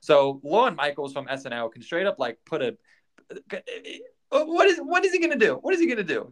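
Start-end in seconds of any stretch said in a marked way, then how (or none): 4.3-6.3 high-pitched exasperated wail; exasperated high cry. Real, not acted. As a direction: whine it out in disbelief. Ranting, voice breaking up high.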